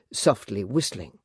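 ordinary delivery, nothing marked

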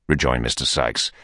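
usual speaking voice

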